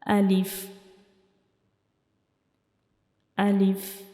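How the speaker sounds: alif said alone, carefully; model alif for learners. Shown as a demonstration. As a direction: say alif as a letter to repeat. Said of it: "दोबारा read करें".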